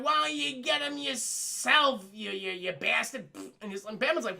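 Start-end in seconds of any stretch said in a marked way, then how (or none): 0.0-3.3 crazed Alfred. Retold or imitated. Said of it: "and Alfred's like".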